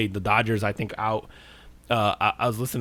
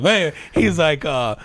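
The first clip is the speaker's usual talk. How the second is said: ordinary delivery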